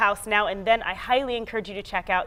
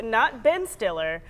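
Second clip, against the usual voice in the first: ordinary talk, nothing marked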